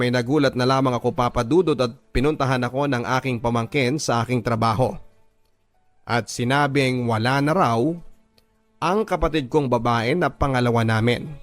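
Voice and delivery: plain reading voice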